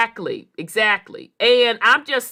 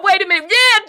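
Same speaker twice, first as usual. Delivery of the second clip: usual speaking voice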